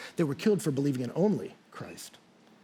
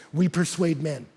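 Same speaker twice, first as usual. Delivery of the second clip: ordinary talk, nothing marked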